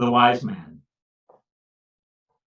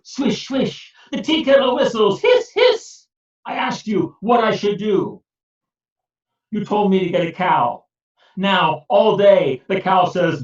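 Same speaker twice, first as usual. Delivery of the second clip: higher, louder exasperated rant; angry complaint; 8 semitones higher, 5 dB louder than usual. Acted. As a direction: vent your fury at the wise man. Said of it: "Peter was very angry".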